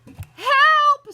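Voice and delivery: high, very loud shriek; panicked woman screaming; scream for help. A narrator's reading. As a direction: cry out in terror. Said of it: "screamed Missus Jenkins".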